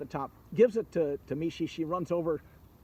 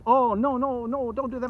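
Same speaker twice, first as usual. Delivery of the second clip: high, louder pleading no no; alarmed dragon; 8 semitones higher, 6 dB louder than usual. Acted. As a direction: beg him to stop, high and urgent. Dragon begs to save the well.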